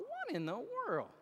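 high, hushed mock astonishment; soft, high-pitched wonder; retold amazement. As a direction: replay being quietly floored, pitch jumping up. Retold astonished thought at kindness.

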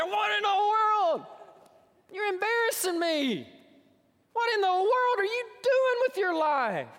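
high, loud hollering in mock fury; furious scolding parent. Imagined parents confronting their wayward daughter, furious scolding. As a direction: scream it like a parent at wit's end.

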